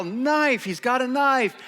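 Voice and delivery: high-pitched mock yell of alarm; urgent alarm call, not full volume; retold yell. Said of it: "And so I yell".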